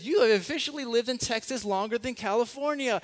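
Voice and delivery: wife rubbing in the news with glee; excited wife. Retold or imitated. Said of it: "She goes".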